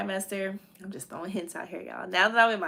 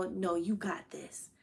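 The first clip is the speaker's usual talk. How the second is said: whispered, slow reassurance; soft whisper; 9 dB quieter, slower than usual. Retold or imitated. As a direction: soothe her in a whisper. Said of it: "my mom was really like".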